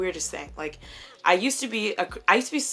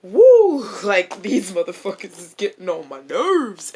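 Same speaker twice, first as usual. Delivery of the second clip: exasperated outburst; 10 dB louder than usual. Retold or imitated. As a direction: let out frustrated mock venting. Retelling exasperation at work.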